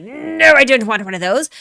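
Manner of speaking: loud, high-pitched imitated rejection; emphatic mock refusal. Streamer voicing Dottore turning down a moon wheel, mock-emphatic refusal.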